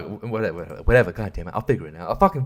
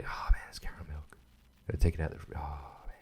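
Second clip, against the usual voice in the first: 1 whisper; 10 semitones lower, 15 dB quieter, faster than usual. Real, not acted. Whispering to self over snack.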